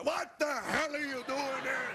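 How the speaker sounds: put-on high, slow outraged German accent; bellow-style mock German scolding, stretched out; German-accented grandfather. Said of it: "My grandfather".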